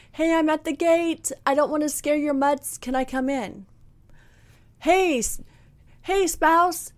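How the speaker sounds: high, timid asking voice; polite newcomer. Pretend. Newcomer asking the wife to come in, high and polite. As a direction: ask permission sweetly at the door.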